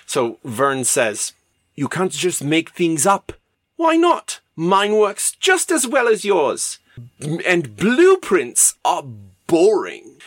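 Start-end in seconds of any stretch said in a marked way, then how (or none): 1.7-3.3 put-on German accent. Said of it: "I'm doing a German accent for a French man". 3.8-10.2 high, loud, drawn-out comeback; dismissive retort. Acted. Wells defending his made-up airship to Verne, loud high retort. Wells argues back in comic skit.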